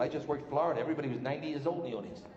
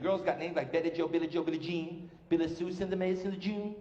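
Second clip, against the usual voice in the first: usual speaking voice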